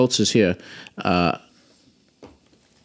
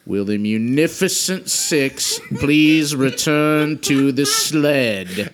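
slow deliberate repeat; 4 semitones higher, slower than usual. Acted. Wizard repeats the embarrassing summons, slow and deliberate. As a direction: grudgingly spell it out one last time. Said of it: "I'm only going to say this one more time".

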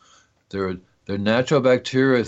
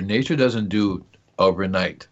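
usual speaking voice

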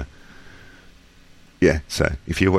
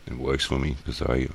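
ordinary talk, nothing marked

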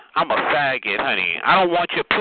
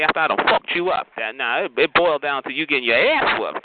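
ordinary delivery

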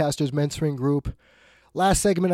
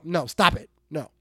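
normal talking voice

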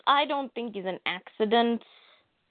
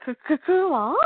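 mock stunned stammer, rising into the name; 7 semitones higher than usual. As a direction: stutter the name in disbelief, higher pitched. Stunned reaction voiced for character.

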